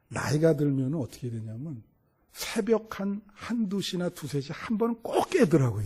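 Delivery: ordinary delivery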